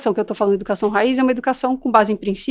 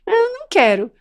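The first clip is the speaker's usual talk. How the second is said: put-on much higher whiny child refusal; 8 semitones higher than usual. Expert voicing the child refusing, higher. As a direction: whine like a stubborn kid. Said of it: "cada um conhece seu filho".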